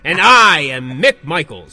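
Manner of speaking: slow, loud ring announcer; boxing announcer. Shown as a demonstration. Host demonstrating his intro style, loud ring announcer. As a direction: boom your own name like a fighter intro. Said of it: "See what I mean?".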